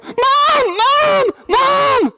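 put-on little boy shouting for his mother; kid yelling for mom. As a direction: yell it like a scared little boy. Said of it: "I hear Griffin go".

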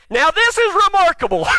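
high, shouted mock astonishment; sarcastic amazement. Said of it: "now he's being sarcastic".

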